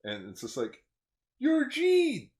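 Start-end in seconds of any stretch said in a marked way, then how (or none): 1.4-2.3 high, loud startled cry; shocked outburst. Retold or imitated. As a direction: blurt it in total shock. Character shocked meeting.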